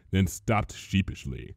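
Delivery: plain reading voice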